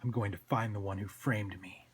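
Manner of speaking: low, quiet brooding vow; low-voiced vengeful hero. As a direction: deliver it low and grim, like a dark antihero.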